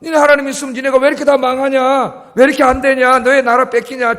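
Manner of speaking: acted heckling of a defeated people; mocking taunts. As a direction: taunt them with scornful questions, rising to a shout. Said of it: "조롱했다는 거예요".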